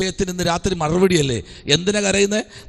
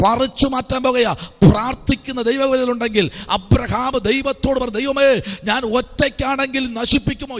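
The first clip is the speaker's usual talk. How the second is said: impassioned preacher, climbing higher as the plea builds; fervent preaching; 6 semitones higher than usual. Acted. Preacher retells Abraham pleading with God for the city, fervent and rising. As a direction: preach it hard, stretching the line endings.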